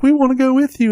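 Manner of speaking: high-pitched imitation of little kids; pleading little siblings. Little siblings begging big brother to come along, high imitated plea. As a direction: pipe up like a pack of small children. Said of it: "all his little brothers and sisters are like".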